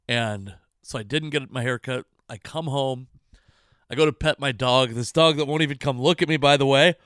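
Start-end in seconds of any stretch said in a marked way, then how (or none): none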